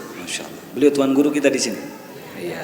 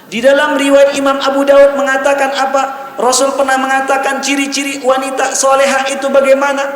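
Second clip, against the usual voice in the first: high, sustained preacher's call; full-force preaching; 10 semitones higher, 6 dB louder than usual. Acted. Preacher asking the congregation about righteous wives, impassioned. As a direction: put the question at full pitch.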